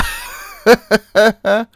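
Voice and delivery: loud, high burst of happy laughter; delighted laugh. Real, not acted. Gamer laughing at being offered a big job.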